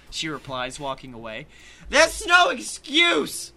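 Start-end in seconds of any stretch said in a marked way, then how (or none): 1.9-3.5 high, loud, drawn-out scream; outraged high scream, words stretched; screaming after someone. Acted. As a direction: yell it after a departing waitress. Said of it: "I scream after her".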